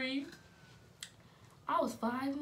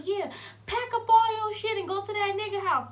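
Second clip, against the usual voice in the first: high, loud mock order; super mad; 10 semitones higher, 5 dB louder than usual. Mom ordering daughter out of house, super mad. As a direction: throw them out like a furious mom. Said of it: "super mad".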